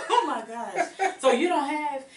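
laughs through the words; laughing. Real, not acted. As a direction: giggle as you start the question. Laughing mid-question.

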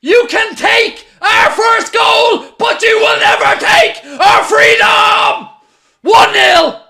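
screaming with joy, high and loud; ecstatic yelling. Real, not acted. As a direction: roar the celebration. Celebrating the winning goal.

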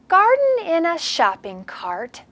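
slow, lively picture-book read; storybook voice for young kids; reading to children. Acted. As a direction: read the big book to the class. Reads big book aloud.

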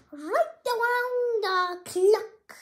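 slow, loud drawn-out punchline. Acted. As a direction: land the joke big and slow. Punchline ending the story.

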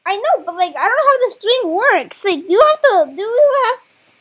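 loud, high, rattled outburst; panicked protest. Real, not acted. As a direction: blurt it out loudly in real alarm. Panicking as he is being scammed.

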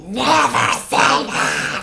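slow, stretched-out mock warning; melodramatic brooding. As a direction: drag each word out with hammy gravity. Hammed-up quote of brooding Edward.

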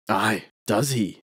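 slow, mildly sharp retort; a hint of anger, drawn out; flash of anger. A narrator's reading. The stranger answers Paulinus's boast about his God with mild anger. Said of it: "with a flash of anger".

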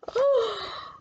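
high, drawn gasp of mock fright; storyteller's big pretend gasp; dramatic gasp. Storyteller reacts to the scary roar, gasping.

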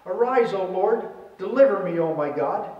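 usual speaking voice

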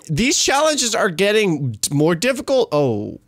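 usual speaking voice

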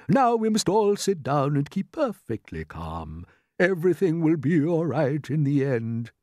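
put-on steady everyone as an old grasshopper; old green grasshopper. Grasshopper urges all to keep calm, measured and reassuring.

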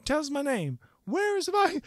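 high imitated yell; frantic calling mom. Mom searching the party for her son, frantic calls. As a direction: call out like a mother searching room to room.

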